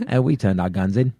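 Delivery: put-on Australian accent drifting into Cockney; Michael Caine. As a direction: attempt Australian but slide into London vowels. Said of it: "that was Michael Caine".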